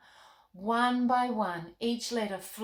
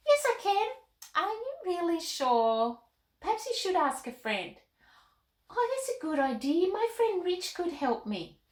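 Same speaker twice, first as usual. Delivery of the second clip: put-on much higher, bubbly young girl; little girl Molly voice; 9 semitones higher than usual. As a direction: chirp like an excited little girl puppet. Puppet Molly answers Carmen.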